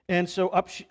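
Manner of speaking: ordinary talk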